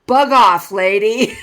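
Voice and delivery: loud, snappy "Bug off, lady"; a toddler telling a stranger to go away; blunt toddler retort. Retold or imitated. Toddler snaps at grandma in line, loud blunt retort. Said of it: "He looked up at her and goes".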